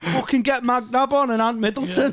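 mock blokey dad. Mock dad scoffing at Shakespeare.

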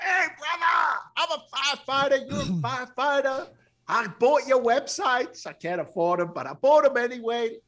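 high, eager, gushing fan voice; excited fan gushing. Retold or imitated. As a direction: bubble over like a starstruck fan. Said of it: "I'm all excited".

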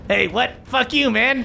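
high indignant goblin voice. Acted. Goblin reacts to being punched, high indignant voice. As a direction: protest in a high-pitched squawk.

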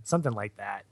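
ordinary talk

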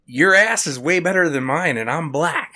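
an outraged classmate, hammed up; mock indignant girl. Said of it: "She goes".

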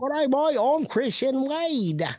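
high-pitched, drawn-out bad British accent; botched British accent. Host showing co-host his shaky accent, high hammed-up British. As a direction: do a poor British accent that slides into Australian. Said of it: "That's his British accent".

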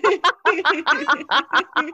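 bright giddy laugh; laughing at herself; happy laugh. Real, not acted. Recalling her screaming, giddy laugh.

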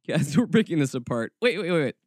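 normal talking voice

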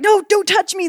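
high, urgent retold protest; mock outburst pushing the helper away; shouted command. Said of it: "shouting out commands to him".

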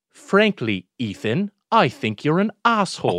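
put-on British accent, crisp retold insult; hammed-up British delivery, each word set out. Kid stands up to bully with brother's line, in British accent. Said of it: "in a British accent".

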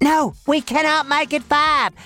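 firm refusal. Acted. Host overruling his impatient guest, firm refusal. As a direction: shut the request down firmly.

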